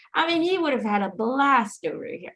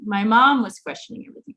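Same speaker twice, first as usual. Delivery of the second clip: normal talking voice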